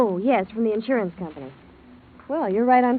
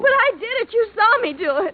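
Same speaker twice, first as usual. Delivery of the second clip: high, loud desperate insisting; hysterical; 11 semitones higher, 5 dB louder than usual. Acted. Secretary insisting to the detective she killed him, hysterical. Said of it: "Don't get hysterical on me".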